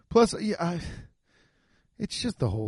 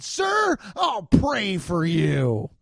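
mock-pious declaration, high opening then drawn out; very respectful voice; 5 semitones higher, much slower than usual. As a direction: address the boss with slow, exaggerated respect. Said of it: "in a very respectful voice".

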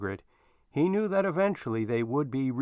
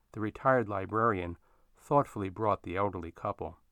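usual speaking voice

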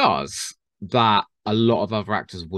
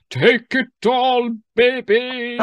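high, slow, hammed-up drawl; hammed-up Matt Smith; 12 semitones higher, 6 dB louder, much slower than usual. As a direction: camp it up, stretching every word. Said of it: "He's like".

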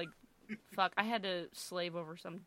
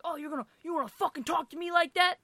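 lightly voiced tough cop, higher pitch; mock tough guy; 8 semitones higher, faster than usual. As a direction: imitate a cop trying to act tough. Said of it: "trying to be, like, a tough guy".

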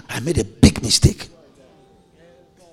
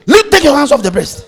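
mock scolding wife; 9 semitones higher, 7 dB louder than usual. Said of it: "When you touch the breast".

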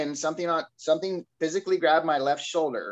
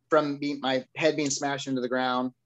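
ordinary talk

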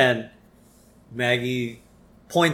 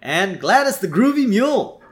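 big, high, loud TV presenter voice; hammed-up celebrity self-introduction; Troy McClure; 6 semitones higher, 5 dB louder than usual. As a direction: sell it like a showy infomercial host. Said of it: "he starts off with this whole".

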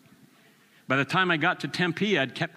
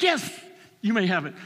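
much higher, delighted quick yes; eager God; 7 semitones higher than usual. Retold or imitated. God answering the repentant asker, eager and high. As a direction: play God beaming with eagerness.

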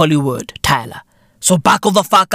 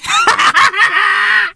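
high laugh; 13 semitones higher than usual. Real, not acted. Laughing at jumping mid-rant, high-pitched laugh.

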